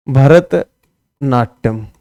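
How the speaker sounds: Bharatanatyam drawn out very slowly, in two parts; भरत, a pause, then Natyam, both stretched out; slow dictation. Shown as a demonstration. Teacher repeats term slowly for students.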